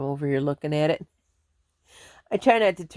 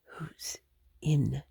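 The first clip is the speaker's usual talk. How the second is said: hushed, wary whisper; 9 dB quieter than usual. Real, not acted. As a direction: breathe it out, listening for the intruder. Hears a car outside.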